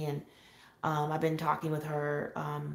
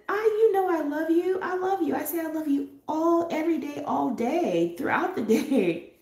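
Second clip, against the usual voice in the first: laughing; 10 semitones higher, 4 dB louder than usual. Retold or imitated. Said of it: "I said".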